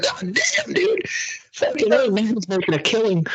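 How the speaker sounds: fake-gasp at the price; mock shock. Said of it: "I was like".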